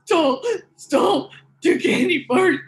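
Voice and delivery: high, sobbing confession acted out through hiccups; imitated sobbing. Four-year-old confessing to the store manager, imitated sobbing. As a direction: replay a four-year-old's crying apology. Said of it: "I was hiccuping, crying".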